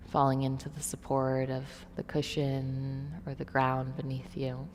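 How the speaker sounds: soft, calm yoga nidra voice; guided meditation. Real, not acted. Leads guided relaxation.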